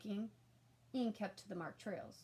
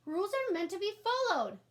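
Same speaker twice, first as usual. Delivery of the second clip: much higher, louder shout; child's shout; 11 semitones higher, 8 dB louder than usual. A narrator's reading. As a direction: yell the rule in a panic. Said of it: "Ian shouted".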